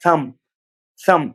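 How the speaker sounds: "thumb" said as "thum", ending on M; silent B. Shown as a demonstration. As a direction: say "thumb" with no B sound. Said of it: "ये बी pronounce नहीं होगा".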